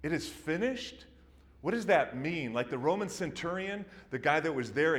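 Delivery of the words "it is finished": "It is finished" rising like a baffled question; puzzled question. Retold or imitated. Onlookers puzzling over Jesus's words, baffled rising question. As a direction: ask "finished?" in confusion. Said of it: "could you imagine the confusion".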